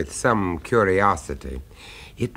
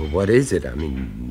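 ordinary talk, nothing marked

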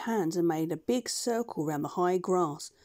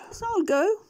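much higher, shy creature voice; gentle beast agreeing; 13 semitones higher, 5 dB louder than usual. Acted. Push-Me-Pull-You agrees to go with the doctor, high shy voice. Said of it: "said the Push-Me-Pull-You".

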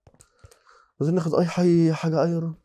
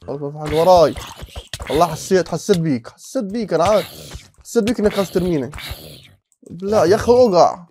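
agitated suspicious shouting; 3 semitones higher, 8 dB louder than usual. Real, not acted. Gamer accusing an unseen mob of pushing him, loudly. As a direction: call out the sneaky mob.

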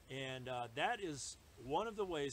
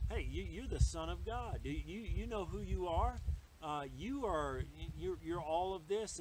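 higher, louder mock flattery; goading Satan; 4 semitones higher, 5 dB louder than usual. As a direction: lightly act out Satan egging someone on. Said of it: "when he says".

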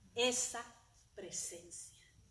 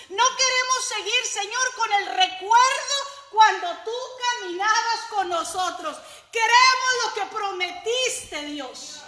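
much higher and louder, slow desperate cry; crying out to God; 10 semitones higher, 19 dB louder than usual. Retold or imitated. Disciples crying out to God for the Spirit, shouting. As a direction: cry out like a crowd begging heaven. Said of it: "Estaban clamando y gritando".

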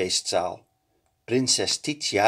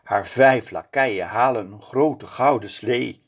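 usual speaking voice

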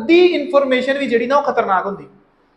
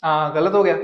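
ordinary talk, nothing marked

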